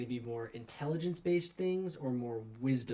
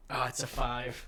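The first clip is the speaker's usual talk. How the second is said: ordinary talk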